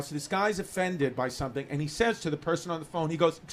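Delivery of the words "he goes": normal talking voice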